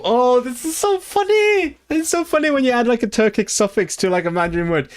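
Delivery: real giddy, high-pitched amusement; tickled delight. Real, not acted. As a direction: squeal with delight, then ease down. Tickled by hybrid loan word.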